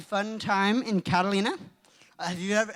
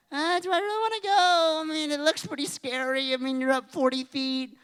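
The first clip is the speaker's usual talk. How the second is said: higher, reluctant worrying; mildly hammed-up jitters; retold nervousness; 6 semitones higher than usual. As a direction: act out wavering before a scary ride. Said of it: "I was kinda scared".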